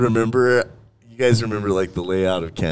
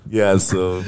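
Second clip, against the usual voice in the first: ordinary talk, nothing marked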